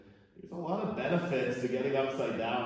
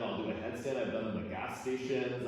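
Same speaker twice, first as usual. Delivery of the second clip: normal talking voice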